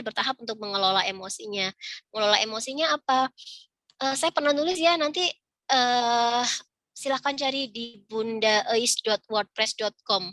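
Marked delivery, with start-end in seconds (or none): none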